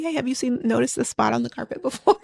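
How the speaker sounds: soft, offhand question; casual. Retold or imitated. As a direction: imitate someone asking casually, no urgency. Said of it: "he just said casually".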